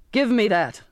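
angry captain's command; crying out. A narrator's reading. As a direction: demand it as you advance. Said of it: "the captain cried".

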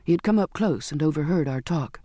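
plain reading voice

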